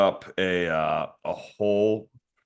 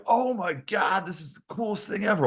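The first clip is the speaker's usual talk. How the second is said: excited high voice; 13 semitones higher than usual. Retold or imitated. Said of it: "they're like".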